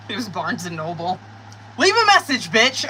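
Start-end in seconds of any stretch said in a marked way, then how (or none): 1.8-2.9 loud, high yell at the caller; exasperated yell. Real, not acted. Reader annoyed by Barnes and Noble calling, loud exasperated yell. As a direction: shout it at the phone, fed up.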